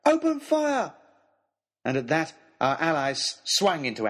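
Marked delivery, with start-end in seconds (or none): 0.1-0.9 high, ringing battle command; shout. A narrator's reading. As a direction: cry the order to the troops.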